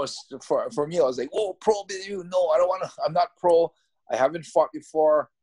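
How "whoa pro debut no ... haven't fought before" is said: high, louder, alarmed objection; a startled rookie refusing a pro debut; startled protest. Retold or imitated. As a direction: caught off guard, backpedalling fast. Stunned at offer of pro debut.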